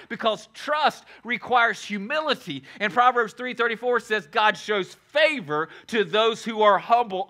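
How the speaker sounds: usual speaking voice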